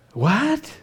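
sharp high rising what; a high startled what; shocked what. Retold or imitated. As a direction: hit it like disbelief. Shocked reaction to the news.

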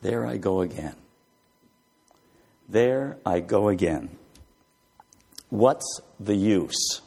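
slow hopeless sigh of a line; defeated murmur. Acted. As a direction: sag like someone who has given up. Voicing defeated inner thoughts.

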